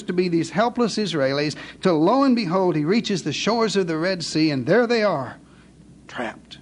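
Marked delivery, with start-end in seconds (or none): 6.1-6.6 breathy whisper, dropped low. Acted. Preacher whispers to the congregation. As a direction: whisper it like a trap springing. Dramatic pause in sermon.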